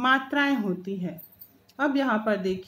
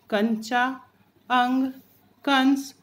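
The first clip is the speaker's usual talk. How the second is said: usual speaking voice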